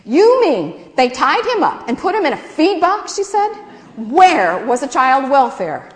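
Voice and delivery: high, loud indignant outburst; excited outrage. A narrator's reading. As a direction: blurt it out scandalized. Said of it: "Imogene got all excited".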